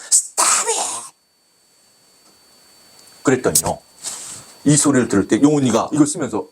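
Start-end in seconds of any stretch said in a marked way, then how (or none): 0.1-1.1 breathy, high hissed "Stop it!"; imitating the maestro hissing "Stop it!" at the singer; hissed rebuke. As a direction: spit the words out under the breath. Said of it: "이런 뜻이에요".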